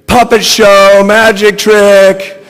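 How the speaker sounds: high, very loud squeal. A narrator's reading. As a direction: squeal it, drawn out slow. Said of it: "the whole time squealing".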